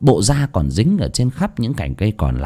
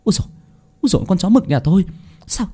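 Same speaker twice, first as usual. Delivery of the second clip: high, fast, alarmed wail; panicked; 10 semitones higher, faster than usual. A narrator's reading. Mrs Xuân finding her dog hanged, fast high panicked wail. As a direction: gasp it out fast in shock. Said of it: "bà hốt hoảng".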